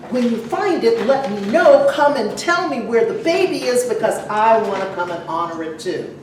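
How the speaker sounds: ordinary talk, nothing marked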